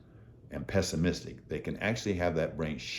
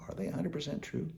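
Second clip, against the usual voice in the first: breathy near-whisper, much softer and higher; quiet questioning; 10 semitones higher, 8 dB quieter, faster than usual. Shown as a demonstration. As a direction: lean in and ask it softly to yourself. Coaching viewer to question thoughts.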